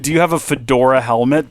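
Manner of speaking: ordinary talk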